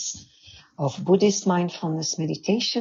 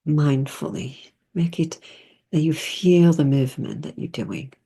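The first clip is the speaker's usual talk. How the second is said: guided meditation. Real, not acted. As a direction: ease them into stillness, phrase by phrase.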